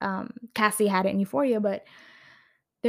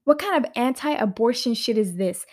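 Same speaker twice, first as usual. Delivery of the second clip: outraged disbelief; 4 semitones higher, 5 dB louder than usual. Retold or imitated. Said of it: "I was like".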